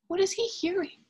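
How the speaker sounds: wondering aloud. Acted. Asking children, suspense.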